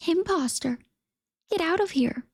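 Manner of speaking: shrill little hen yipping at the duckling. Acted. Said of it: "yipped the hen".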